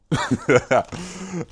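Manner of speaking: sudden amused laugh; high burst of laughter. Real, not acted. Laughing at thumbnail problem.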